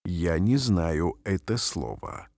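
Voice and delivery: ordinary talk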